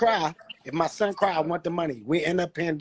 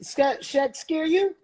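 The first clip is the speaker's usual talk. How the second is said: gentle coaxing; 9 semitones higher, slower than usual. Real, not acted. Father asking his small son if Shaq scared him, gently coaxing. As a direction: ask a little one slowly and sweetly, pitched up.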